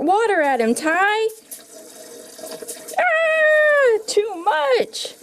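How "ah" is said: high, loud squeal of shock; pretend scream. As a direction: yelp as water hits. Toy hit by water.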